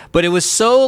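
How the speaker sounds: normal talking voice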